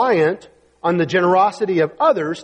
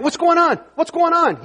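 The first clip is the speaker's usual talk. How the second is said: high, raised call; crying out; 9 semitones higher than usual. Acted. Blind man asking the passing crowd, crying out. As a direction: call out urgently, pitched high. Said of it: "he cried out".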